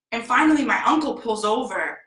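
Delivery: usual speaking voice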